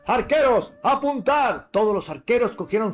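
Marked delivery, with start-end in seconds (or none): 0.1-1.7 command to archers. Acted. King orders the archers.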